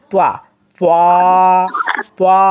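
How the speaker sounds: তোয়া in a thick, full heavy sound. Shown as a demonstration. Teacher demonstrating the heavy-voiced তোয়া. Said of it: "মোটা আওয়াজ হবে".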